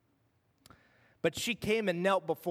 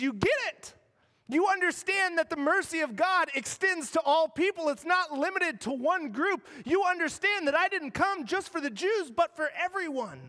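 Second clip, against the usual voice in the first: higher, lifted, joyful insistence; eager affirmation; 7 semitones higher than usual. Acted. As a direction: let it ring out with delight. Preacher voices Jesus' meaning.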